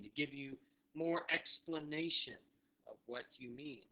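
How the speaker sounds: ordinary talk, nothing marked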